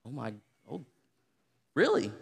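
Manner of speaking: usual speaking voice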